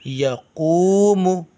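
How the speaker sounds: या कूमू with या quick and कूमू drawn out; slow recitation drill; short या, long कूमू. Shown as a demonstration. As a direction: clip या, stretch कूमू. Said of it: "या को जल्दी पढ़ेंगे".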